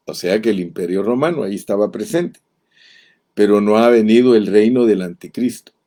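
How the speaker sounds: normal talking voice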